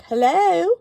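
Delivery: a high, loud "Hello" in a mock English accent. Imitating the attorney commercial's English-accent greeting. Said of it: "they always do it in an English accent".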